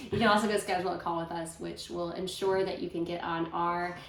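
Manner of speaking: ordinary delivery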